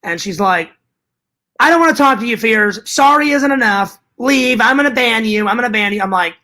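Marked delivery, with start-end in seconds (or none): 1.6-6.0 imitating angry Carrie. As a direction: act out someone mad, refusing an apology. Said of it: "Carrie got really mad at me".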